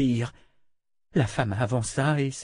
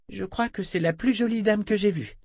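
put-on much higher child voice; little girl; 9 semitones higher than usual. A narrator's reading. Girl calling the woman the prettiest lady, childlike. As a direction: confide in a high, childlike voice.

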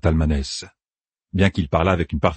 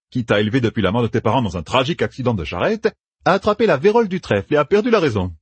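higher, quick, relishing the tale; Matt spinning a backstory; 8 semitones higher than usual. A narrator's reading. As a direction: reel off the fake biography with gusto. Narrator voices Matt.

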